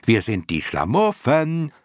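high, drawn-out chant; chorus of moths calling. A narrator's reading. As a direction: chant it slowly like a gang of clown moths. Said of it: "riefen alle Clownmotten im Chor". (gedehnt im Chor rufend)

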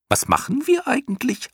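very high squeaky creature voice; curious clown moth. A narrator's reading. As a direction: squeak it as a wondering question. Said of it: "wollte eine andere wissen". (neugierige Piepsstimme, sehr hoch)

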